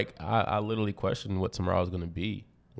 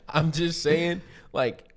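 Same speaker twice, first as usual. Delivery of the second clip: high laugh into speech; laugh, then keep talking; 9 semitones higher, 4 dB louder, much slower than usual. Real, not acted. Laughs at his own point.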